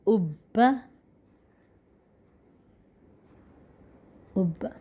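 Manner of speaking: "اب" as ubba, the b held long before release; geminated ub-ba for "اب"; pressed doubled consonant. Shown as a demonstration. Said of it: "زور لگا کے".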